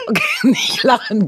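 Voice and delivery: giggling through the words; can't stop laughing, high-pitched. Real, not acted. Trying to gargle, cracking up.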